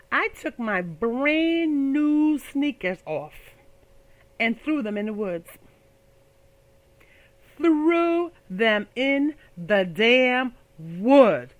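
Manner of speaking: very slow, stretched last line; dramatic slow punchline. Real, not acted. Comic telling the crowd the punchline very slowly. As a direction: drag out each word for the laugh.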